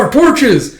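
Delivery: high, loud cry as the torches are cut; panicked shout. Retold or imitated. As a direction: yell it in sudden alarm. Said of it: "He's like".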